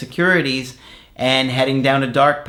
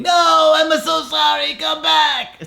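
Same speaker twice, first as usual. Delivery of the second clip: much higher, slow shouted apology in a goofy cartoon voice; cartoon man begging; 14 semitones higher, slower than usual. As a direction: wail the apology like a desperate cartoon guy. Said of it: "he's begging and pleading".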